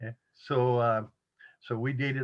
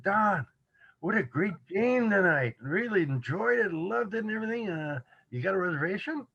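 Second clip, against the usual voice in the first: higher, eager voice of a thrilled restaurant owner; delighted fan; 7 semitones higher than usual. Retold or imitated. Restaurant owner gushing over last night's game, eager and higher. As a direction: gush like a fan meeting his hero, lightly acted.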